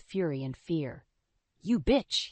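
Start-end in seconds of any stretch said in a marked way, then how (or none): 1.6-2.3 spat out. A narrator's reading. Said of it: "he spat".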